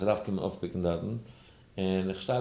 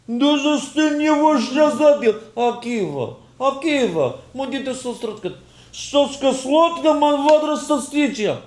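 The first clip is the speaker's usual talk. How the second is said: very high, loud drawn-out yelling; hammed-up Ukrainian shouting at Akiva; acted screaming; 9 dB louder than usual. (אויפגעהיצט שרייען אויף אוקראיניש)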